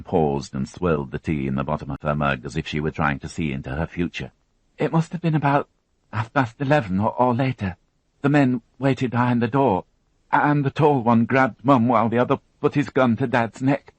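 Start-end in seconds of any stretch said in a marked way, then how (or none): 4.8-13.9 put-on higher, tense young woman recounting an ordeal; higher, strained witness voice; Alison, shaken young witness. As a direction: voice her as a frightened girl holding it together. Alison recounts parents' return.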